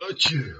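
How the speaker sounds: loud real sneeze; a sudden sneeze; sneezing. Real, not acted. Sneeze during live stream.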